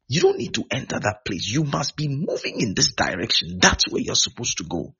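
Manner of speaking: forceful, repeated beseeching; insistent pleading. Acted. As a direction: plead with people to change course. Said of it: "telling people".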